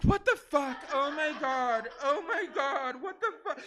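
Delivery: high, loud appalled laugh-shout; shocked laughing. Retold or imitated. Comic reacting to stranger's gross punchline. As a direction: recoil in disbelief.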